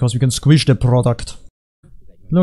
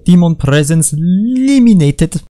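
slow, loud, higher game announcement; drawn-out mock system voice; game announcer; 5 semitones higher, 6 dB louder than usual. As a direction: boom it out like a hammy announcer. Mimics the game's announcer.

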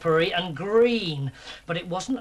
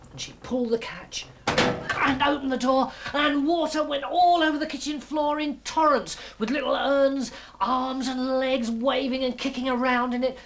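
high, breathless-paced storytelling climax; raised, dramatic children's storyteller; excited narration; 7 semitones higher than usual. A narrator's reading. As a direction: sweep the listener along as disaster bursts out. Climax: water bursts out.

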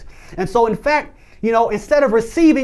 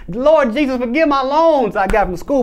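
higher, half-joking plea to the Lord; mock prayer; 4 semitones higher than usual. Preacher jokingly prays to Jesus about school loans, mock plea.

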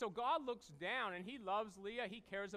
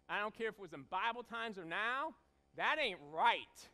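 ordinary talk

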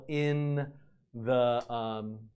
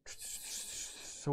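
whisper; 13 dB quieter than usual. Real, not acted. Muttering to self mid-search.

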